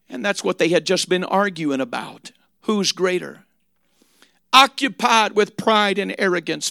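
soft, hushed, gentle sermon close; quiet tender preaching. Acted. Preacher softly telling the congregation to just wash their feet.

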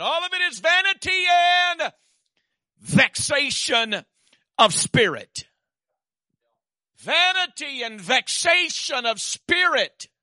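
loud, high, slow preaching cry; full-force preaching. Acted. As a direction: drive the verse home.

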